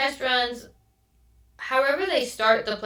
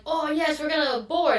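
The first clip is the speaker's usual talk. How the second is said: higher-pitched, hopeful cheer; lifted, eager "finally" reaction; mock excitement; 6 semitones higher than usual. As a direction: act out relieved passengers getting excited. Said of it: "everyone gets excited".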